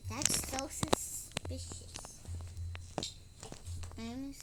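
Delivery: hushed scared whisper; nervous whispered aside. Real, not acted. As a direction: lean in and whisper to the viewers.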